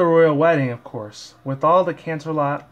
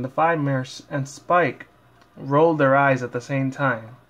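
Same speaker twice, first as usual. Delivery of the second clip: ordinary delivery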